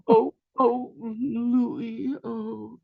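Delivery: hammed-up teenage girl crying his name; weeping girlfriends. Imitating the girls crying to him over the phone, high and wailing.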